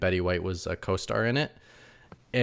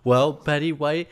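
mock Ryan; 6 semitones higher than usual. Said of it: "then he goes".

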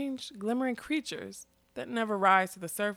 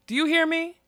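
higher and louder, demanding; fierce shout; 9 semitones higher, 6 dB louder than usual. A narrator's reading. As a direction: snap it at him to wake him up. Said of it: "said fiercely".

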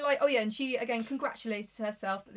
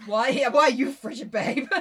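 louder, laughing mock complaint; mock whiny Rochester; 10 dB louder than usual. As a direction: whine it as a hurt fiancé. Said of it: "Rochester's imagined complaint".

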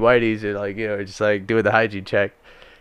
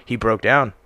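usual speaking voice

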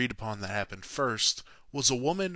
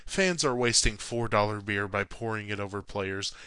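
ordinary delivery

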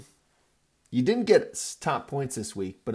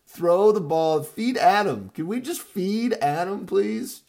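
frustrated shout; 8 semitones higher, 8 dB louder than usual. Retold or imitated. Yelling at his team's coaches.

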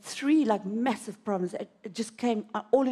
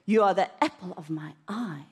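lower, tender voice; voice of God; 4 semitones lower than usual. Acted. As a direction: speak as a loving Lord. God's voice spoken to her.

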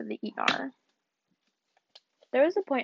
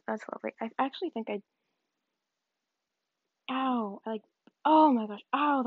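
ordinary talk, nothing marked

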